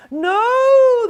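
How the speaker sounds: high, loud no; high yelled no; crowd yell. Acted. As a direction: shout it as one crowd. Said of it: "the crowd yelled".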